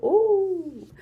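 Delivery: a high, loud ooh of surprise; high surprised ooh. Real, not acted. Reading a viewer's question, a high surprised ooh. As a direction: react like you've spotted trouble.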